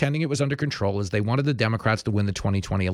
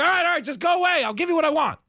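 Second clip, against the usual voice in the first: very high, hurried pleading; hammed-up frantic giving in; mock panicked surrender; faster than usual. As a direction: cave in fast, voice shooting way up. Acted victim giving in, panicked.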